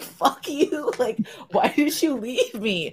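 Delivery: high, laughing outrage, slowing at the end; mock furious squeal. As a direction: yell it at the husband, half laughing. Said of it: "I was like".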